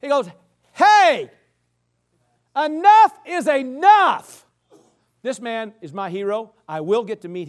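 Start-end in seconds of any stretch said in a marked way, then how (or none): 0.7-4.4 loud, high outburst, slowing for emphasis; exasperated shout to cut off the talk; fed up. Acted. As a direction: act out a young man who has had enough. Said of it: "finally gets fed up with it".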